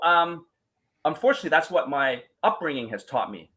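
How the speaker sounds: ordinary talk, nothing marked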